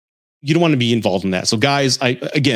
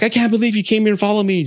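fast, high mock shouting; mock yelling wife; 9 semitones higher, faster than usual. Wife yells at her husband who followed her, fast and high. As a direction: rattle it off as an angry wife. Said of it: "they're yelling at each other".

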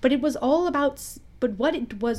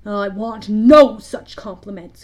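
slow, loud, petulant refusal; petulant bark; 10 dB louder, slower than usual. A narrator's reading. Hook rejecting Smee's compliment, barking petulantly. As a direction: bark it out, offended. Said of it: "Hook barked petulantly".

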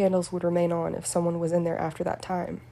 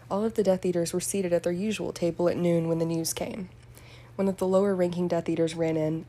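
plain reading voice

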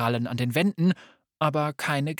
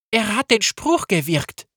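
high, loud and out of breath; gasping; 8 semitones higher, 7 dB louder than usual. Acted. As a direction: blurt it out between gasps. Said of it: "keuchte sie".

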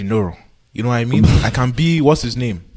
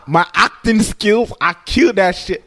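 boastful; 6 semitones higher, slower than usual. Real, not acted. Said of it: "My charisma".

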